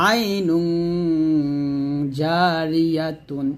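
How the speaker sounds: আইনুং held on a long nasal hum, জারিয়াতুন slow; recited ghunnah. Shown as a demonstration. Teacher reciting the example, long nasal on আইনুং. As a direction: chant slowly, humming the end of আইনুং. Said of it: "আইনুং গুণ করবেন".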